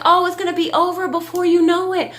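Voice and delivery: a hint of a brave seven-year-old; light mock child reassuring herself; imitating Nova. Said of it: "She's like".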